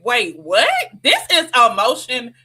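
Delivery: a touch of hammed-up astonishment; amazed reaction. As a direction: replay being struck by an incredible story. Acted amazed reaction to family story.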